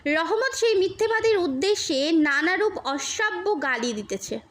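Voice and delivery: ordinary delivery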